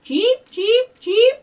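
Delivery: loud, very high rising cheeps; bird cheeping. Acted. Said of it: "What kind of bird sounds".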